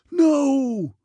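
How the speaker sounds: long, high, loud wail of protest; scream. A narrator's reading. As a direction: scream and draw it out. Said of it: "screamed Andrews again".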